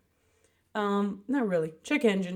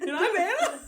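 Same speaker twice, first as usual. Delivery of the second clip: mock unsure intro; 12 semitones higher, 4 dB louder than usual. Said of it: "You weren't sure".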